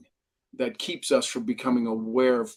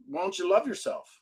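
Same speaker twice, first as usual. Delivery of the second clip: gentle mentor; 5 dB quieter than usual. Retold or imitated. Mentor's question on the phone.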